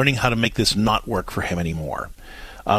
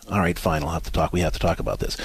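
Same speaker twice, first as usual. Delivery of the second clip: lower, softer grudging give-in; grudging husband; 7 semitones lower, 4 dB quieter, faster than usual. Retold or imitated. Husband finally giving in to his wife, grudging and low. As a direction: concede reluctantly, low and soft.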